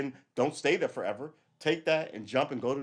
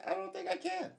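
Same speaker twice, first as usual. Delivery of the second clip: much higher, softer mock-sad whine; regretful falsetto aside; 7 dB quieter than usual. Real, not acted. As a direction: squeak out a disappointed answer. High quiet voice.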